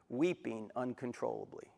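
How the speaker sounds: normal talking voice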